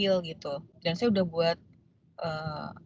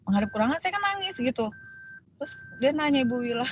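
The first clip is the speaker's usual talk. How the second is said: ordinary talk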